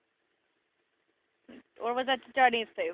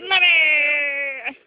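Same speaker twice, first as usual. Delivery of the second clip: loud, higher wailing call; crying out for mommy; 4 semitones higher, 7 dB louder than usual. Acted. Child wailing loudly for mommy. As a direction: wail for mom like a hurt child.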